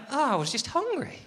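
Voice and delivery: high, light "oh" of discovery; relieved realisation. Acted. Comic after a sandwich, high mock-surprised realisation. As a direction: realise it suddenly, pitched right up.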